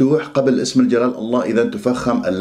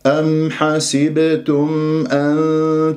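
very slow, drawn-out tajweed recitation; Quran recitation; much slower than usual. Shown as a demonstration. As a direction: recite solemnly with long held vowels. Recites the verse.

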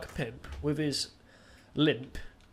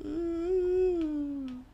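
drawn-out high fake cry; a hammed-up high crying wail; mock wail; 3 dB louder than usual. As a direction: wail one long note in pretend grief. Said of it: "I'll just cry deeply".

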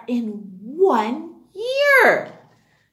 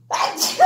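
sneezing. Real, not acted. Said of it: "Sorry about the sneezing".

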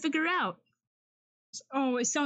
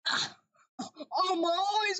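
normal talking voice

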